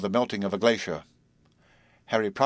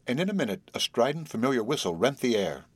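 plain reading voice

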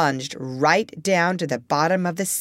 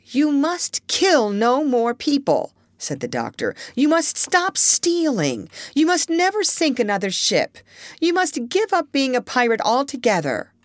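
stern doctor; 8 semitones higher than usual. A narrator's reading. Doctor gives orders slowly.

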